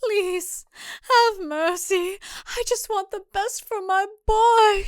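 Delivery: high, slow, pleading whimper. Acted. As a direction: beg for mercy, cowering. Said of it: "she whimpers".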